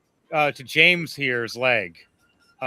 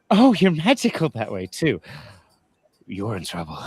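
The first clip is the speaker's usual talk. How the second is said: excited queen; 5 semitones lower than usual. Acted. Queen excited, then teasing.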